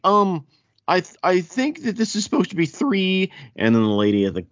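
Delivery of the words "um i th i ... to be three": sheepish tourist voice. Retold or imitated. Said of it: "And then I was like".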